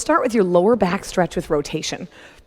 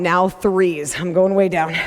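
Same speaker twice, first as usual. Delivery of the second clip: ordinary delivery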